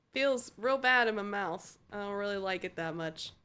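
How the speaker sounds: ordinary delivery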